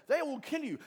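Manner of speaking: high, loud alarmed declaration; voice of doom. Acted. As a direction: announce it as a disaster. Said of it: "No one else is saying that".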